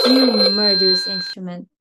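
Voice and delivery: loud curse at the phone; furious. Acted. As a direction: hurl it at the telephone. Cursing the telephone.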